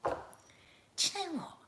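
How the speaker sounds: slow, quiet quoted friend, pitched high; lightly voiced friend starting a rant; imitating friend. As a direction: friend opening her story. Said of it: "she came in and she went".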